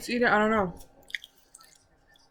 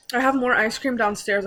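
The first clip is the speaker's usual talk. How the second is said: ordinary delivery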